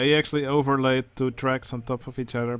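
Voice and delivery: ordinary talk, nothing marked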